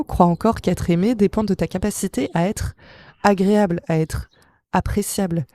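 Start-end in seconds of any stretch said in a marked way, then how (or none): none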